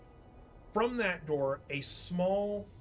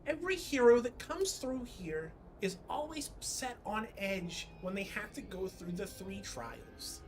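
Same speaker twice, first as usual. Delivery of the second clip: soothing pixie voice; 5 semitones higher than usual. Acted. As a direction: coax gently like a tiny pixie. GM voicing pixie.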